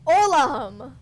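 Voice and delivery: loud desperate scream of a name. Acted. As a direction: scream his name in panic. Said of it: "I hear Ginzo scream".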